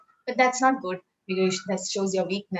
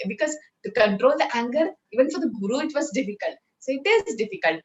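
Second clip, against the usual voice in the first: normal talking voice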